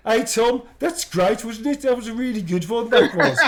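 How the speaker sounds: put-on loud, high broad Birmingham accent; thick Birmingham accent. Unmuted host chatting to Tom, loud thick Birmingham accent. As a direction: bellow it in thick Brummie. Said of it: "the thickest Birmingham accent you've ever".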